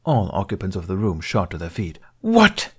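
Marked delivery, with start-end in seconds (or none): 2.2-2.7 high, loud startled squeak. Acted. Senator hearing of the flagship disaster, squeaking. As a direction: yelp it out in shock. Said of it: "Me squeaked".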